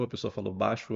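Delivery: ordinary talk, nothing marked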